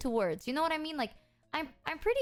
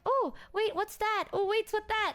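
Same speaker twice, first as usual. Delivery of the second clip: high-pitched eager retold questions; curious excitement; 9 semitones higher than usual. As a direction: pipe up, intrigued by each new thing. Retelling her curious home-tour reaction.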